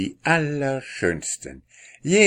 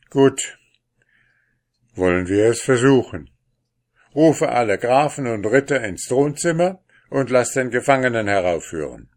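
lightly voiced king, a bit louder; a king giving orders, slightly raised; 4 semitones higher, 7 dB louder, faster than usual. The king commanding his servant, lightly voiced.

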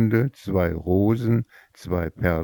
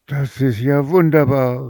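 higher and louder, thrilled; delighted cry; 7 semitones higher, 5 dB louder than usual. Acted. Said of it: "rief die Mutter".

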